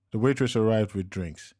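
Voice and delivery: ordinary delivery, nothing marked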